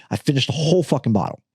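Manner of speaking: ordinary talk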